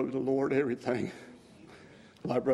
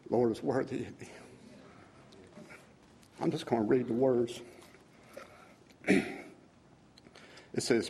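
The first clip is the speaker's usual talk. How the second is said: ordinary delivery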